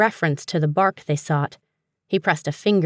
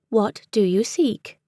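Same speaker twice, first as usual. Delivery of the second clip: higher, slow, formal British voice; crisp British accent; 5 semitones higher than usual. A narrator's reading. Book answering the seeker, crisp British accent. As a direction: repeat it in crisp British English. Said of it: "in a crisp British accent".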